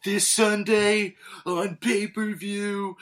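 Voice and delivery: booming, slow, high promo voice; wrestling promo. Retold or imitated. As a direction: hype it like a wrestling announcer. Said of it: "if you're cutting a promo".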